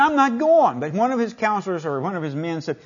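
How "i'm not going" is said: higher, louder mock-huffy flat refusal; indignant refusal. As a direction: toss it off like an offended general. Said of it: "He was indignant".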